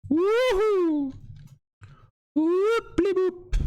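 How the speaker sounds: very high squeaky cheer; excited squeal. Real, not acted. Streamer squealing with joy over new emotes, high squeaky cheer.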